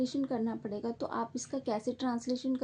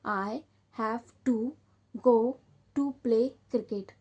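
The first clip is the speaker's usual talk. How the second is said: very slow, word by word; slow dictation; much slower than usual. Shown as a demonstration. Teacher dictating the translation slowly to her students. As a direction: give it slowly so they can write it down. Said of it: "मैं आपको translation करके बता रही हूँ".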